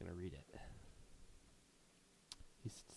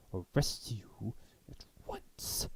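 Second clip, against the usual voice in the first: slow whispered threat; menacing whisper; threatening whisper; much slower than usual. Acted. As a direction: hiss the threat out slowly. Official threatens arrest.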